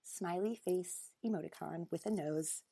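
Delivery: usual speaking voice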